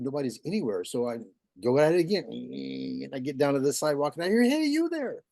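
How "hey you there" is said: high-pitched bird calling out; goldfinch calling. Acted. Unseen goldfinch to man weed whacking, high-pitched calling. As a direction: pitch it way up, a sudden call.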